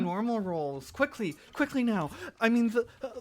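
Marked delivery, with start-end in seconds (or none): 0.9-2.1 high, hurried urging; urgent hurry. Acted. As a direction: rush them along, pitched up.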